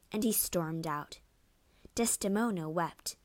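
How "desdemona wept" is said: ordinary delivery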